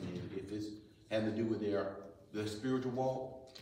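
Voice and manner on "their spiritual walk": usual speaking voice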